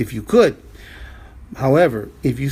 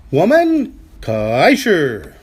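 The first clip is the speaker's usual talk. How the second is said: slow; much slower than usual. Shown as a demonstration. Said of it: "teacher modelling slow Chinese".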